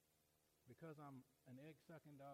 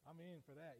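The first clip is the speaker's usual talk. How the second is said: normal talking voice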